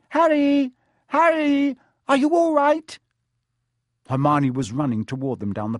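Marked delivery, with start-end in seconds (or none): 0.1-3.0 put-on very high, loud girl's cry; alarmed calling out. A narrator's reading. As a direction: call urgently to a friend from down the path. Hermione calls while running up.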